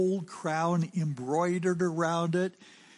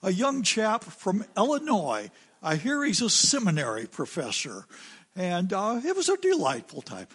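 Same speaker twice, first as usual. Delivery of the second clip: lightly imitated British king, pitched up; a hint of an Oxford-accented king reminiscing; posh English king; 4 semitones higher than usual. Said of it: "notice the young".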